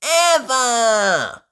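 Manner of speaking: high, loud, thrilled tasting; delighted Peppa. A narrator's reading. Peppa tastes a tomato and raves, high and delighted. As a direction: rave about the tomato. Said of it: "says Peppa, tasting one".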